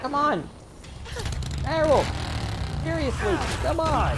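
frantic yelling. Real, not acted. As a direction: scream for him to hurry up.

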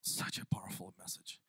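softly whispered aside; a whispered, awed aside; whispered awe. Real, not acted. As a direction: breathe it out in awe.

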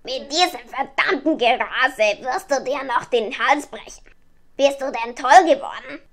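loud raven telling her off; schimpfender Rabe. A narrator's reading. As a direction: scold the reckless flier as the raven. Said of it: "schimpfte der Rabe". (laut schimpfende Rabenstimme)

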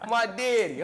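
normal talking voice

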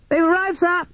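very high-pitched police sparrow call; high squeaky announcement to a superior; shouting sparrow constable. A narrator's reading. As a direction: call it out high, like a little bird. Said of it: "shouted P C Sparrow".

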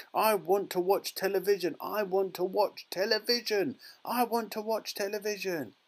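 high, insistent tiny voice, repeating; squeaky tantrum. A narrator's reading. Tiny boy keeps demanding television, squeaky tantrum. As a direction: squeal it over and over. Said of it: "He squeaked".

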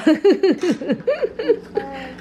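long burst of laughter; helpless giggling run; laughing. Real, not acted. Amused at the toddler.

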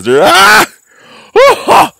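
a short, loud, high-pitched "ah!"; sudden yell. Real, not acted. Vlogger yelping at the camera over the stolen pumpkin, sudden high yell. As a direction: let out a startled yelp at the camera.

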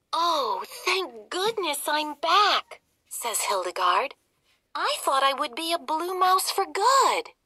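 high, loud relieved cry; relieved princess. Acted. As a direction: sigh of relief being back.

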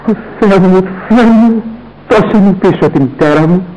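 anguished cry. Acted. Son cries out as mother dies.

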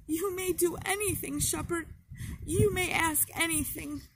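put-on a clinging girl pleading not to be sent back; desperate, tearful entreaty; pleading through sobs. A narrator's reading. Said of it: "sobbing over and over again".

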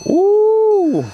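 long high squeal of delight; delighted ooh. Real, not acted. Host thrilled as the new radio powers on, high squeal. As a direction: swoop way up with excitement.

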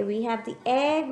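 ordinary delivery

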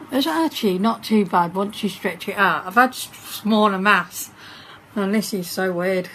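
talking with a face mask on; wearing a sheet face mask. Real, not acted. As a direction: lips moving through mask opening. Seen 0: Sheet mask on her face throughout, mouth opening left free.

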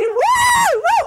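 very high, loud whooping. Retold or imitated. Children running in Shabbat clothes, whooping. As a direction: shout with glee.

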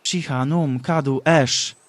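slow, very loud combined incantation; booming double spell. Acted. Combined spell cast angrily.

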